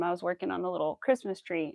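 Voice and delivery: usual speaking voice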